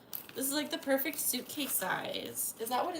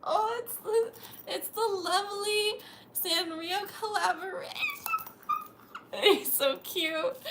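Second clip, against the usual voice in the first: loud, high, slow gushing; excited; 7 semitones higher, 5 dB louder than usual. Real, not acted. Thanking her friend for the Sanrio gift, high thrilled squeal. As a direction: squeal over how pretty it is.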